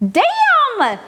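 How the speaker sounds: sudden loud high Damn; surprised exclamation. Real, not acted. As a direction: blurt it out in shock. Reacting to the stream alert.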